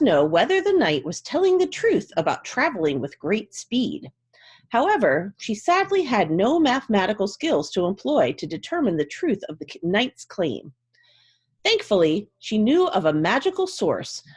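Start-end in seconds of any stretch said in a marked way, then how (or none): none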